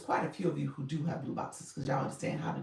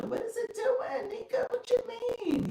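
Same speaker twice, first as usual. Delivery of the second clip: baffled. Real, not acted. App won't send the goal.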